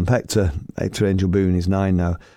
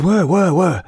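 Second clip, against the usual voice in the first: mock panic; 10 semitones higher, 5 dB louder, slower than usual. Panicked reply about the snake.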